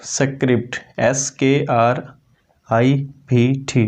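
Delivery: स्क्रिप्ट then letters एस के आर आई पी टी spelled slowly; spelling out slowly. Shown as a demonstration. Teacher spelling script letter by letter for students. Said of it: "तो स्क्रिप्ट".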